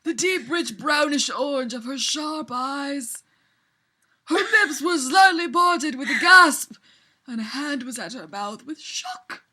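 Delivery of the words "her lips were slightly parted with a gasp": dramatic read. Acted. Dramatic fanfic reading.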